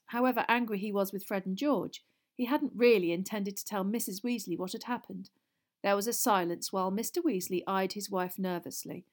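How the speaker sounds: usual speaking voice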